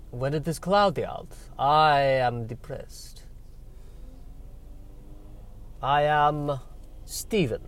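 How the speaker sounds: put-on slow, deliberate Dracula-style accent; Eastern European vampire accent. Depressed vampire introducing himself, Eastern European accent. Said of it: "I have this accent".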